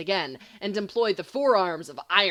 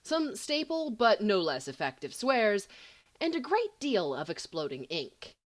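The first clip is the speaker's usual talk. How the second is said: usual speaking voice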